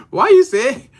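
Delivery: laughing through a question about how it was said. Real, not acted. Host laughing at the chat over his slip. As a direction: giggle out why you say it like this. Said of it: "laugh heard".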